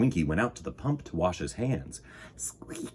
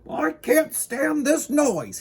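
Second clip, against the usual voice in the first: drawn-out, high, loud whine of protest; high, stretched-out exasperated cry; high-pitched complaint; 5 dB louder, slower than usual. A narrator's reading. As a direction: complain slowly in a high, fussy character voice. Complaining character voiced in story.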